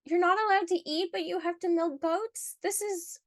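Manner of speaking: high-pitched, appalled disbelief; incredulous. Real, not acted. Reacting to the writer milking goats while denied food, incredulous.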